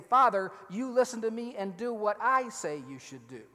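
commanding son. Retold or imitated. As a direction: tell the father off, he must obey. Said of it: "He is saying".

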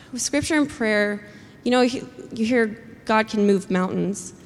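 ordinary talk

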